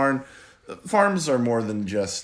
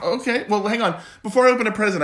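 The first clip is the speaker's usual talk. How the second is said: delighted opening a present; 10 semitones higher, 5 dB louder, faster than usual. Pretend. Dad playing along with gift.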